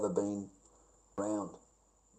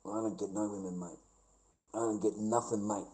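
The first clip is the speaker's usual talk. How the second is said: usual speaking voice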